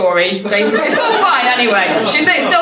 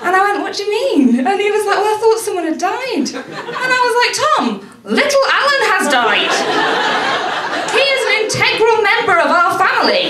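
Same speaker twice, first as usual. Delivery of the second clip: shrill-free but much higher indignant plea; high indignant grief at boyfriend; high outrage; 12 semitones higher than usual. Retold or imitated. Retold indignant outrage at Tom.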